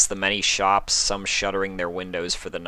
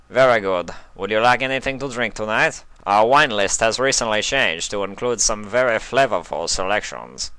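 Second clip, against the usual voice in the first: louder, formal waiter's voice; a polished head waiter offering wine; 3 semitones higher, 4 dB louder than usual. A narrator's reading. Head waiter offering the wine list to the couple, formal voice.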